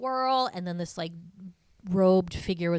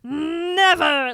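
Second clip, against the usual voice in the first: Never with a rolled trill, much higher and louder; 10 semitones higher, 11 dB louder than usual. Retold or imitated. Podcaster imitating Aggie's dramatic trilled Never. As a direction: trill the r in Never like an old Hollywood star. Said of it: "she does this, like, weird, like, trill when she's doing never".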